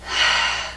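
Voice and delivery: long breathy sigh out; demonstrated exhale. Shown as a demonstration. Teacher showing learners how to breathe out, long sigh.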